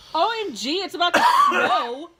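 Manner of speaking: high, loud mock alarm, laughing; mock shock. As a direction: squeal it in fake alarm.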